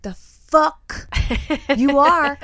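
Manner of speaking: yelling at her partner in the supermarket; retold shout. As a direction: chew him out over the mask. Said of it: "I said".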